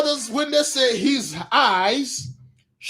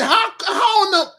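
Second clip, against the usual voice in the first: outraged outburst; 10 semitones higher than usual. Acted. Acting Saul's outraged question.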